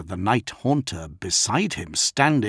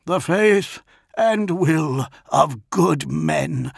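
high, slow, declamatory reply; Dantiok; 7 semitones higher, 4 dB louder, slower than usual. A narrator's reading. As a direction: answer the villain with raised, measured defiance. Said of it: "replied Dantiok".

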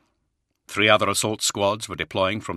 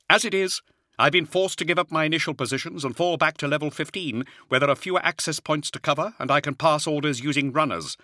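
much higher, strained colonel voice; a harried Jaeger officer reporting under pressure; Hadziel, harried officer; 9 semitones higher than usual. Acted. Officer's voice held by narrator.

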